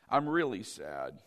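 low, soft mock-sad voice; hammed-up gloom. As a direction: fake a droopy sad voice. Preacher mocks faking sadness.

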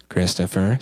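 name said very low, like a warning; imitated stern mom. Mom catches her son dodging, imitated low warning. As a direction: imitate a mother who means business. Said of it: "She calls me Christopher".

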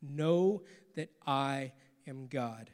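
"Know that I am God" said slow and soft, each word weighed; hushed and deliberate; slow, quiet emphasis. Shown as a demonstration. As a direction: lean into "Know that I am God" softly and slowly. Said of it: "focus on that last half".

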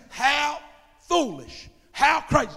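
loud mock scorn, each phrase hit hard; scornful onlookers. Preacher voices onlookers judging street evangelists, loud and scornful. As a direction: imitate neighbours loudly scoffing at the believers.

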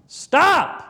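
sharp, stern, very loud and high; a father's stern shout to his sons. Retold or imitated. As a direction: bark it out to stop the kids cold. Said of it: "sternly shouted".

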